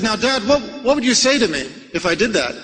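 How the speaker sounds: ordinary talk, nothing marked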